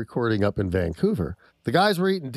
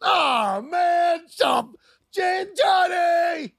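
high, drawn-out shouted protest; imitated group outcry; 3 dB louder, much slower than usual. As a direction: cry out like the grossed-out diners. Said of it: "demonstrate to the best of your impersonation".

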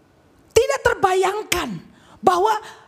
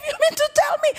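much higher shouted question; incredulous shout; 10 semitones higher than usual. Retold or imitated. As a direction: yell it in disbelief. Peter's disbelief acted out.

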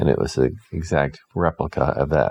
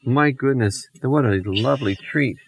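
friendly dog; 6 semitones higher than usual. Acted. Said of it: "the big old friendly dog said".

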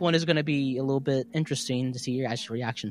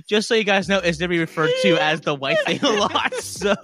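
loud, laughing explanation; giggling while trying to carry on; amused announcement; 4 semitones higher, 6 dB louder than usual. Real, not acted. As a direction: push the words out through laughter. Cracking up over the creature's name.

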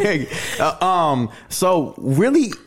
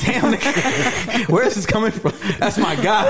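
high, laughing disbelief; slow stunned damn, then laughs; amazed laughing reaction; 6 semitones higher than usual. Retold or imitated. Retelling reaction to friend's voice.

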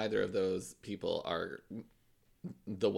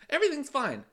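very high, louder fake-cheery chirp; mock cheerful denial; 5 dB louder than usual. Teasing a friend about denying trouble, mock cheerful denial. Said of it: "I love the narrator in your life".

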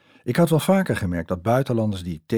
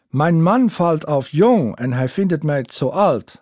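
put-on high, slow German-accented Dutch, louder; 8 semitones higher, 8 dB louder, slower than usual. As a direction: play the German boyfriend charming her. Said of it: "zei hij tegen mij".

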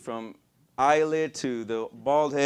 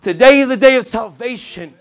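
shouted sermon declaration, higher pitched; loud proclamation; 8 semitones higher, 15 dB louder than usual. Real, not acted. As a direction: belt it out to the whole congregation. Preacher proclaims the key line.

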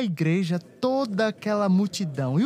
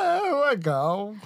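unconvincing; much slower than usual. Acted. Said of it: "Deixa da tua mentira".

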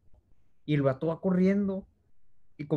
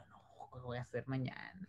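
susurro preocupado; 4 semitones lower, 21 dB quieter than usual. Retold or imitated. Se acuerda de su pánico.

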